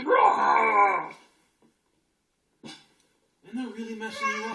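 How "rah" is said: much louder, higher mock roar; roaring block. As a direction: roar like a cranky little monster. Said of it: "your little blocks are going".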